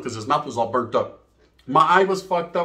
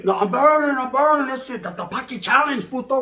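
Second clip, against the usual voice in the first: high, raised whiny wail; mock burning victim; 8 semitones higher than usual. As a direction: whine like your mouth's on fire. Maced guy complains of burning.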